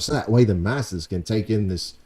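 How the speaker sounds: ordinary talk, nothing marked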